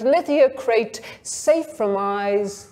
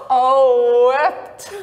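giggling exclamation; laughing; 3 semitones higher, 4 dB louder than usual. A narrator's reading. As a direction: gasp it out between giggles.

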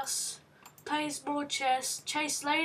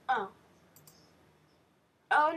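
usual speaking voice

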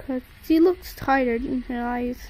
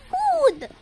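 put-on baby voice; 13 semitones higher than usual. Baby asks food.

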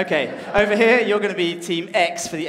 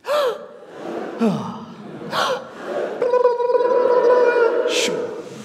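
sharp gasps, long high sound, closing shush; gasp, hold a high noise, finish on a shush; breathing warm-up noises; 5 dB louder than usual. Shown as a demonstration. Music leader gives audience sounds to copy, warm-up noises.

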